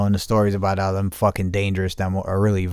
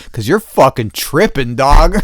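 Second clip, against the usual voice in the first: retorting; 4 semitones higher, 8 dB louder than usual. Retold or imitated. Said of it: "I said".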